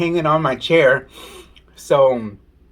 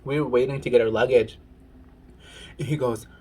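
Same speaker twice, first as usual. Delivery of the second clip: ordinary talk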